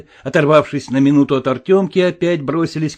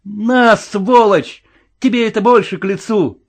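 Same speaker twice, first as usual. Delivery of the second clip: loud, higher, spat-out taunt; defiant anger; 5 semitones higher, 4 dB louder than usual. A narrator's reading. Boy throwing his disguise at Подтяжкин, angry and defiant. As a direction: hurl the insult in their face.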